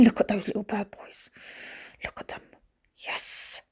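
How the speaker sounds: whispered cooing over the plants; hushed delight. Real, not acted. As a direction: drop to a soft, doting whisper.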